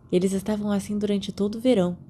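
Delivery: ordinary delivery, nothing marked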